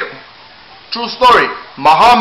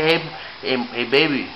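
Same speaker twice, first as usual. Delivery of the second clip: soft, sweet-talking call to the wife; 6 semitones lower, 10 dB quieter than usual. Real, not acted. Sweet-talking the wife before asking about Cuba. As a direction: coax her gently, softer. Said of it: "I have to talk sweet".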